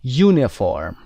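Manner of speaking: Uniform said with a clear yoo sound at the start; teacher models Uniform beginning with yoo. Shown as a demonstration. Teacher models the yoo sound for the class. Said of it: "صدای یو داریم".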